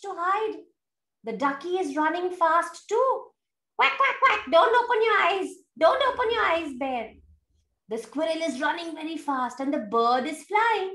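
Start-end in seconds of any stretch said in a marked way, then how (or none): 3.8-4.5 higher hurried quacks; quacking. Acted. Duck running to hide. 4.5-7.1 higher, louder playful warning; teasing warning. Acted. Animals telling bear not to peek.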